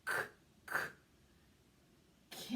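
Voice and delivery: breathy "k-" repeated; sounding out. Shown as a demonstration. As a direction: whisper the "k-" sound. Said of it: "sounding out cat".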